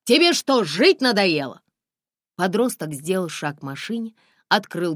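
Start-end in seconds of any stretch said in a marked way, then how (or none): 0.0-1.6 yells out the car window, furious; shouting. A narrator's reading. Driver yelling at a reckless teenager in the road, loud. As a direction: bellow it at the kid. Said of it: "заорал".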